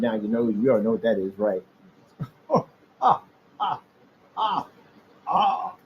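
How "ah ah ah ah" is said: high hammed-up sobbing; imitated ugly cry; mock ugly cry. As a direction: fake blubbering wails. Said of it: "that [name] ugly cry".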